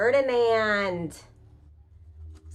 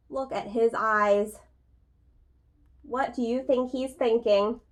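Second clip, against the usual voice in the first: patient picture-book voice with pauses; reading to children; 3 semitones higher than usual. A narrator's reading. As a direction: read it like a teacher at story time.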